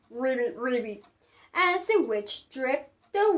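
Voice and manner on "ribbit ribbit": lower, softer frog croak. Acted. The frog croaking as it greets the witch. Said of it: "then said with a croak".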